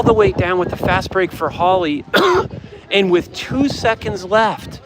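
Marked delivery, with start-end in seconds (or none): 2.1-2.9 a real dry cough from yelling; hoarse cough. Real, not acted. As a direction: cough from a worn-out throat. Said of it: "Sorry, I'm coughing".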